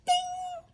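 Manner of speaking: very high, ringing ding; loud, pitched twang sound. A narrator's reading. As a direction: imitate a snapping string with a sharp ding. Imitates second rope snapping.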